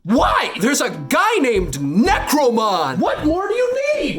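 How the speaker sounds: slow, loud, incredulous shout; drawn-out shouted disbelief; exasperated shout. Real, not acted. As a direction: yell it like it's the obvious answer. Exasperated outburst at viewers.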